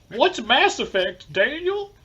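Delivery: high, slow put-on mom voice; a Belgian doing his mom's accent; man doing a woman's voice. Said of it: "my impression of a Belgian person doing my mom's accent".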